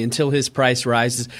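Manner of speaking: usual speaking voice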